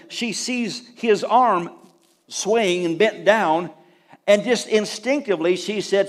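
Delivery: usual speaking voice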